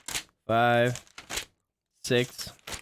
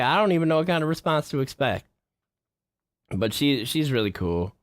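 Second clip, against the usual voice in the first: usual speaking voice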